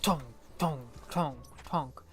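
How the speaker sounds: flat repeated tonk, mimicking a weapon; missile sound effect. Gamer mimics her missile noise, flat and bored. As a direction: mouth the missile firing noise. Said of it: "I just get tired of".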